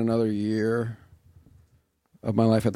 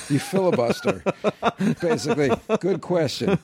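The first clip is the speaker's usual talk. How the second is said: amused; 6 semitones higher than usual. Acted. Acting out exam filibuster while laughing.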